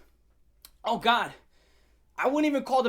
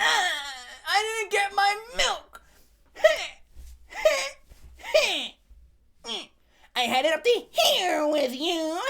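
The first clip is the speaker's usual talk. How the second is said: mock tantrum; 8 semitones higher, 3 dB louder than usual. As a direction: sob it like a spoiled child. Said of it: "With this guy".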